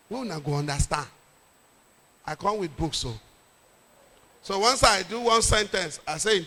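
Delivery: usual speaking voice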